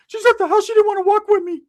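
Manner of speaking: put-on high, loud whimpering teen voice; crying cousin voice. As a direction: wail it like a scared kid cousin. Cousin Ricky answering.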